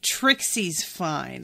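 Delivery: slow deliberate reply; slow and pointed. Retold or imitated. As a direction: drag it out, making a point. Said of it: "just goes".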